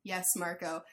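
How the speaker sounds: hushed, submissive answer; meek mock reply. Contestant giving in to the stern chef, meek. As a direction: drop to a small, soft voice, giving in.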